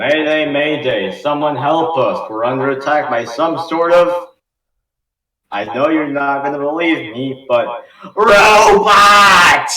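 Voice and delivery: loud, high mayday yelling; panicked distress call. Acted. Camper radioing for help under robot attack, panicked shout.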